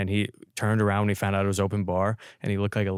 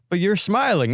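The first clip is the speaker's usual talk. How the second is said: teasing pool player; 10 semitones higher, 5 dB louder than usual. Retold or imitated. Pool player mocking ID photo.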